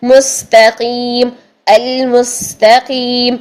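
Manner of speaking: very slow مستقیم with long hissed s; whistling seen recitation. Shown as a demonstration. Teacher showing students this way, مستقیم slow with sustained seen. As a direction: draw out المستقیم, holding s and final ee. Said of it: "اس طریقے سے".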